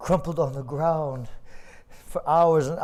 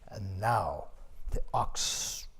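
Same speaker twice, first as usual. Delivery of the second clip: ordinary delivery, nothing marked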